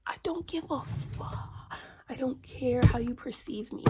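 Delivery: high, loud defiance; defiant outburst. Real, not acted. Dismissing those who judge her, loud defiance. Defiant rant about others' opinions.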